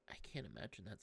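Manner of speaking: ordinary talk, nothing marked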